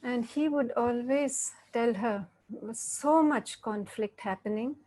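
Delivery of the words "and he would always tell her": usual speaking voice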